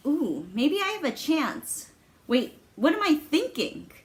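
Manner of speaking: high, swooping excited musing; hopeful inner thought. Acted. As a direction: get your hopes up, then catch yourself. Acted hopeful thought, then self-check.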